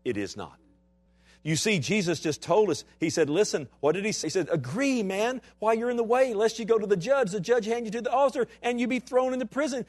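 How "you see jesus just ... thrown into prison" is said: full-force preaching. Acted. Pastor exhorting his congregation, in full-force preaching. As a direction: preach it out to the church, rising.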